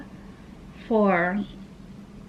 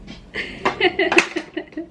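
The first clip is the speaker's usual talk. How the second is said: breathy high giggle with no voice; 8 semitones higher than usual. Real, not acted. Vlogger laughing at being asked if she's single, giggling. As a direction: giggle at the silly question.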